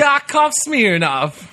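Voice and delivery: high, slow mock Russian accent. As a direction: stretch the name in a Russian accent. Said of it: "I'll go".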